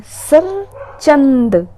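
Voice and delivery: सरचंद stretched very slowly; drawn-out word. Shown as a demonstration. Teacher drags out सरचंद slowly so students memorise it.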